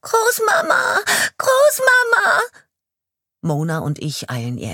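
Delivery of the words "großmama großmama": high, loud sobbing cry for grandma; terrified crying scream. Acted. A terrified little girl running towards the police chief, screaming and crying. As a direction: scream it through tears, close now.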